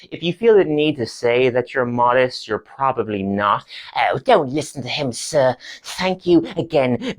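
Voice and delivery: ordinary delivery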